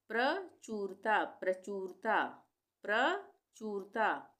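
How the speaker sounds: प्रचुरता drawn out slowly, clear प्र; slow model word. Shown as a demonstration. (प्रचुरता धीरे-धीरे)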